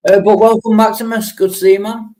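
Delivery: high, loud, bright greeting to a newcomer; greeting viewer Maximus with a big loud welcome; excited welcome. Real, not acted. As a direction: throw a big welcome his way.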